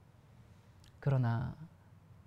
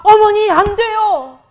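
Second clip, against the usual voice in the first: loud, slow, pleading cry, much higher; desperate cry to stop her; 12 semitones higher, 16 dB louder, slower than usual. Retold or imitated. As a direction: shout it out to stop her from eating. Daughter-in-law stops mother-in-law. (시어머니를 말리며 높고 크게 느리게 외치는 간청)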